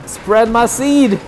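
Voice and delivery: high, slow, drawn-out call; playful battle cry. Pretend. Player throwing bananas in game, playful battle cry. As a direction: stretch it out like a silly war cry.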